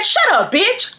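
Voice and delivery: high mocking taunt with a laugh; high taunting thief. Thief's retort.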